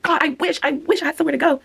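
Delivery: high, longing put-on voice; wistful mock wish. Imagining the viewer dancing alone, high wistful wish. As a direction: sigh it out high, wishing for plans. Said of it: "you're like".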